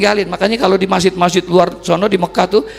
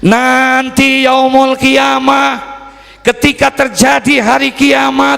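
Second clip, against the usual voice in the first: high, drawn-out, ringing proclamation; full-force preaching; 8 semitones higher, 5 dB louder, slower than usual. Real, not acted. Preacher telling the congregation of Judgement Day, full force. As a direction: build the drama of Judgement Day.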